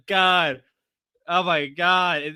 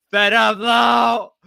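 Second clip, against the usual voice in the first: high, loud, drawn-out yell; exasperated shout; 7 semitones higher, 9 dB louder, much slower than usual. Real, not acted. Streamer yelling at Lalo, exasperated shout. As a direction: shout it slowly at full voice.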